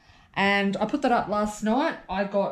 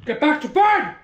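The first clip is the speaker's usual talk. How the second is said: dominatrix voice; 3 semitones higher than usual. Real, not acted. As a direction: order the dog to bed like a dominatrix. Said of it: "That's my dominatrix voice".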